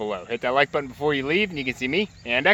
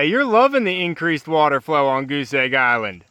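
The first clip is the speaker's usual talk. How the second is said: normal talking voice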